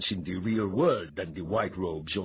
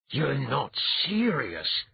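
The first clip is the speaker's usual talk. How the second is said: slow, higher, stunned; shocked exclamation; 6 semitones higher, slower than usual. A narrator's reading. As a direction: gasp it out in disbelief. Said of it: "he exclaimed".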